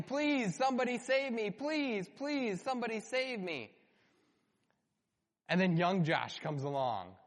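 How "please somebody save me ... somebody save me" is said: high, pleading cry for help; desperate yelling. Pretend. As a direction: call out like you're stuck in quicksand. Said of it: "I could be yelling".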